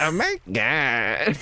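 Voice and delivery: drawn-out copy of a fangirl gush; imitated fangirl drawl. Shown as a demonstration. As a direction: stretch each word in mock awe. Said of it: "that was perfect".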